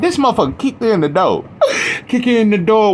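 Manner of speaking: real laughter breaking through the words; laughing. Real, not acted. His dog kicks in the door mid-video, laughing hard. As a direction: crack up as the dog barges in.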